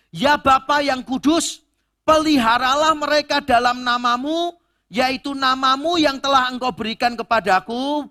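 ringing, high-pitched recitation of a prayer from scripture; scripture reading. Acted. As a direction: proclaim the verse loudly to the congregation. Reads scripture aloud to congregation.

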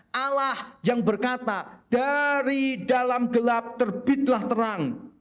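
slow, weighty reading of the verse; solemn scripture quote. Retold or imitated. Preacher quoting Paul to the congregation, slow and solemn. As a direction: let each word of scripture land slowly. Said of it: "Paulus mengatakan".

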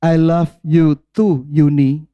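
slow, drawn-out mock husband's text reply. Woman quotes her husband's reply to the pastor, slow and drawn out. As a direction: read the reply out slowly, like the husband typing it. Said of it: "Suami saya balasnya gini".

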